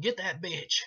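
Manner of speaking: hammed-up holler at a henchman; mock holler. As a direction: bark the order across the room. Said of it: "hollering at Leatherface".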